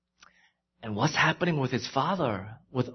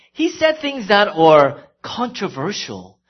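loud, higher impassioned sermon voice; full-force pulpit delivery; fervent preaching; 7 semitones higher, 10 dB louder than usual. Acted. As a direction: preach it, building the heat. Preaching builds again.

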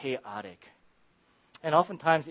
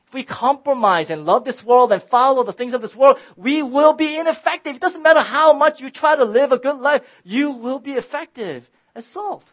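higher and louder, building to a fervent sermon climax; preaching at full force; 11 semitones higher, 11 dB louder than usual. Acted. Pastor urging his small church, full-force preaching. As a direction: preach it out over the congregation, rising.

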